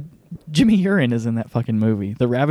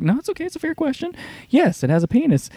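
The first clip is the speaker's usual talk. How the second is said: lightly hammed calm reassurance in a raised voice; Kurt Russell, unfazed; 14 semitones higher, faster than usual. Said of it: "Kurt Russell's like".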